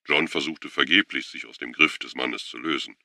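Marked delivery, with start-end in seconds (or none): none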